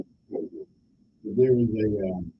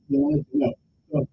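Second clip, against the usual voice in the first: usual speaking voice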